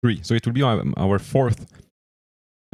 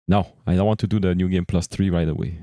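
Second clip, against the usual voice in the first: ordinary talk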